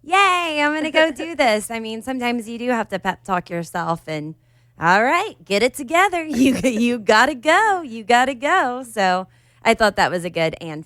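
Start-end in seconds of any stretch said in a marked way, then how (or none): none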